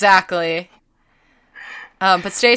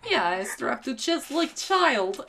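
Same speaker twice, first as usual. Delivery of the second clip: slow, soft, higher mock Russian; deadpan it in a put-on Russian accent; 5 semitones higher, 9 dB quieter, slower than usual. Friend answering whether they can carry it, in a Russian accent. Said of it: "only talking in Russian accents".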